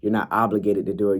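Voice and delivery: normal talking voice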